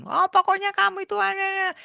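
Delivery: a wife telling off her husband; mock scolding wife. Wife scolds the doctor husband, mock nagging.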